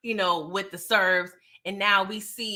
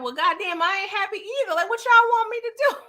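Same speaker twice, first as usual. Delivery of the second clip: exasperated Walter; 11 semitones higher, 3 dB louder than usual. Retold or imitated. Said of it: "he's like".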